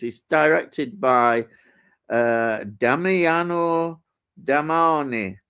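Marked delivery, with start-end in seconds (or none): 2.8-5.4 drawn-out attempt at an Italian accent; poor Italian accent. Shown as a demonstration. Film reviewer reading out the director's name, poor Italian accent. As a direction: put on a slow, amateurish Italian accent. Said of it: "that's not very good Italian accent".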